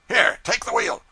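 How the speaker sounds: high, loud command; curt order. A narrator's reading. Pilot ordering the cub onto the wheel, loud and high. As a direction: hand over the wheel gruffly.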